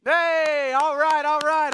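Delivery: excited, loud and high cheer. Real, not acted. Pastor cheering the congregation after they recite, excited. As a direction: cheer them on with delight.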